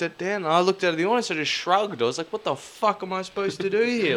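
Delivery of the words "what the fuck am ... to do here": high, exasperated what-now cry; baffled exclamation. Retold or imitated. Dancer shrugging at the audience, high exasperated cry. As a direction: throw up your hands at the crowd.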